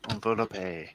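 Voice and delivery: quietly, "envelope" with French-style ends; mock-French pronunciation. Shown as a demonstration. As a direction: echo envelope with a final "ay". Host echoes mock French pronunciation.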